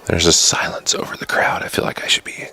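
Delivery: hushed golf announcer whisper; golf commentator whisper. Shown as a demonstration. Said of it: "in my golf voice here".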